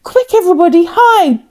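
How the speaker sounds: high, fast, urgent shout of warning; frantic alarm call. Acted. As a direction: shout it to wake the whole village. Boy raises the pirate alarm.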